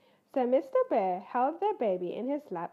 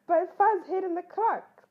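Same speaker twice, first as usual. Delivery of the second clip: higher, louder crying-out cub; cried; 7 semitones higher, 8 dB louder than usual. Acted. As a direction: blurt it out alarmed. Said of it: "cried Ted".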